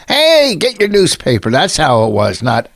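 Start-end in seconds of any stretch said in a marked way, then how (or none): 0.1-1.5 calling out papers on a Chicago corner; Chicago newsboy. Shown as a demonstration. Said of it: "how they did it when they were selling papers in Chicago".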